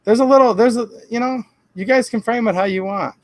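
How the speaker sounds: normal talking voice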